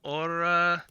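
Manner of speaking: lightly voiced Vistani trader, eager to make a deal; Vistani businessman. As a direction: play a businessman making his pitch, halting between phrases. Game master voices Vistani businessman.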